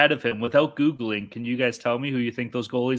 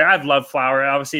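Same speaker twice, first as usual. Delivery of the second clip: ordinary talk, nothing marked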